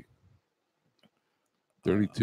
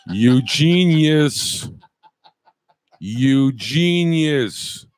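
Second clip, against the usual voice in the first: very slow, louder sarcastic teacher; mock teacher; 3 semitones higher, 5 dB louder, much slower than usual. Teacher mocking a pupil in class, slow loud sarcasm. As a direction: drag out each word like a sneering teacher. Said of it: "imagine being a teacher".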